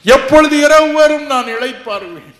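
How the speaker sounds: loud high wail of pain; anguished cry. Retold or imitated. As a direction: cry out for the night to come. Reliving agony, crying out.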